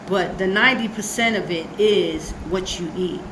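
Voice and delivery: ordinary talk, nothing marked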